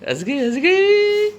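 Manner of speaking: high, drawn-out singsong squeal; stretched-out playful high voice; high-pitched cooing. Real, not acted. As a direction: coo it high and slow to a little one. Cooing to make child laugh.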